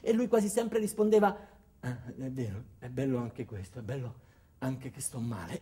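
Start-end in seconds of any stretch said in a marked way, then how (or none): 1.8-5.6 lower, softer, feeble invalid voice; imitating a sick man who agrees meekly; frail patient politely accepting the cheerful nonsense; weak sick man. Sick member answers, resigned.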